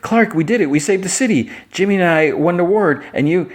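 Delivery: high-pitched, celebratory mock excitement; acted elation. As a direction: play someone bubbling over with victory news. Lois celebrates with Clark.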